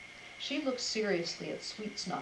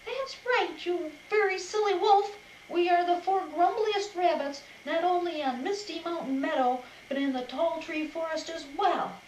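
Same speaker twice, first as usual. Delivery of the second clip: much higher, louder mock-grumpy rabbit; hammed-up grumbling to fool the wolf; grumbliest voice; 9 semitones higher, 4 dB louder than usual. A narrator's reading. Cuddle Mop pretending to be grumpy to the wolf, hammed-up grumble. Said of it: "in the grumbliest voice she could muster".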